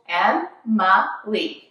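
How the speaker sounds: "Em-ma-lee" slowly in three even beats; "Em-ma-lee" stretched, each syllable set apart. Shown as a demonstration. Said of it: "remember, three syllables".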